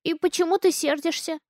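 put-on high innocent little-girl voice; a small girl puzzled by grown-ups; child asking. A narrator's reading. Daughter asks the man why he is angry, childlike.

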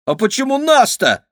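high, loud outraged question; indignant. A narrator's reading. Kira objects to being beaten, high indignant cry. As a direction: protest the unfairness. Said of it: "возмутилась Кира".

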